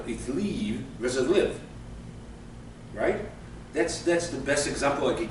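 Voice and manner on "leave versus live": slow "leave" with long EE, then short "live"; long versus short. Shown as a demonstration. Teacher showing English vowel length, drawn "leave" against short "live". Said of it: "It's much longer".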